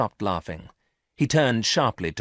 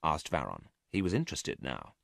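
ordinary delivery

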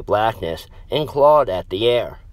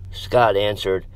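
ordinary delivery, nothing marked

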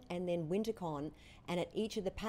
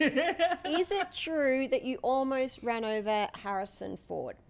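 loud, slow, raised-pitch inquiry; mock-dramatic question; 6 semitones higher, 7 dB louder, slower than usual. Real, not acted. Interviewer asking about nearly running over a star, mock-dramatic. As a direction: draw it out like a big accusation.

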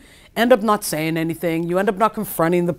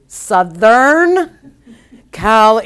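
drawn-out, raised, louder delivery; slow and loud; 4 semitones higher, 8 dB louder, much slower than usual. Retold or imitated. Friend answering the waitress who asks where he's really from, slow and loud. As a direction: spell it out slowly and loudly, imitating an exasperated friend. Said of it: "he's the one that's speaking slow and loud".

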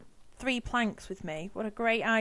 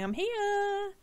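high squeaky little plant voice; 11 semitones higher than usual. Pretend. Player voicing a plant that waves to her, squeaky and high. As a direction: chirp it like a tiny plant waving. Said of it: "it starts waving at you, going".

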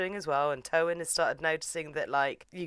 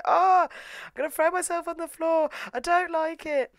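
high, hammed-up mock wail; melodramatic whiner; 14 semitones higher, 3 dB louder than usual. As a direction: whine it high like a drama queen. Mimics melodramatic sulking character.

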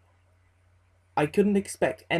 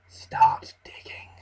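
raspy unvoiced whisper, high and eerie; ominous raspy voice; slower than usual. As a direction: breathe it out like a ghoul. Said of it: "in an ominous, raspy voice".

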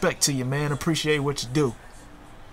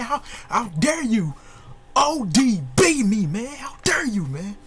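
high, strained indignant voice; how dare you, trembling with rage; outraged, shaking with anger; 7 semitones higher than usual. Real, not acted. Furious at opponent's move.

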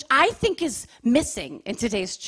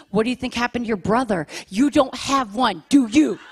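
ordinary talk, nothing marked

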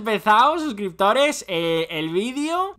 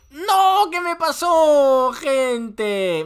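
high, loud no, then slow drawn question; dismayed cry; 6 semitones higher, 4 dB louder, much slower than usual. Real, not acted. His character suddenly shrank. (grito de consternación agudo)

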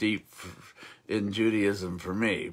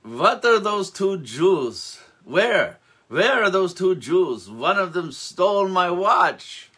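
put-on higher, louder, slow accusing shout in Yiddish accent; angry nobleman; 7 semitones higher, 7 dB louder than usual. Nobleman demanding his stolen watch from the innkeeper, shouting. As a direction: hurl the accusation across the inn.

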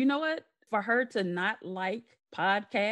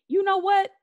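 mama's outburst; 11 semitones higher, 5 dB louder than usual. Retold or imitated. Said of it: "she'll be like".

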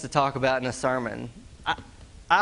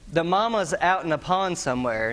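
ordinary delivery

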